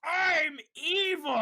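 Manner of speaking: high, slow, drawn-out evil declaration; mock villain scream. Ranting about an obviously evil villain, high drawn-out mock scream. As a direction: announce your villainy, stretching each word high. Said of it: "Everything about him screams".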